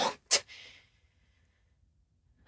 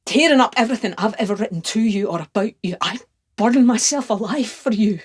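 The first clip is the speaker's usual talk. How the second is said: loud, fervent rush of words; impassioned climax; 3 semitones higher, 5 dB louder than usual. Acted. Lover declaring self-destructive devotion, loud and fervent. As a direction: pour it out with raised voice.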